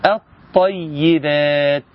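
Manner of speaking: very slow "الطيبات", straight from hamza into doubled ط; assimilated lam. Shown as a demonstration. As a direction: stretch "الطيبات" as at-tayyibaat, no L. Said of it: "اسمع،".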